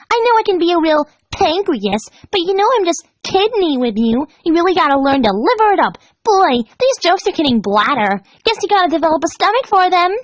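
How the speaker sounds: high, loud comedy routine; Pinkie Pie voice. Acted. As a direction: deliver puns like a stand-up pony.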